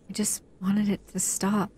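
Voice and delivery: ordinary delivery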